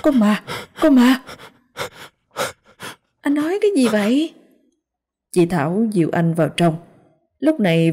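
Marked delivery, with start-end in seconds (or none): 0.0-1.2 high, frightened shout of alarm; panicked cry. Acted. Terrified man raising the alarm about a ghost, panicked cry. 3.2-4.3 high, quick startled question; startled woman. Acted. Woman questioning the man's ghost cry, startled.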